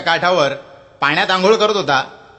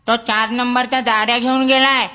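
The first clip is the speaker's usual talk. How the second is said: lightly voiced boy; 6 semitones higher than usual. Boy tells the librarian the book is out, lightly higher. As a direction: call back casually from the back room.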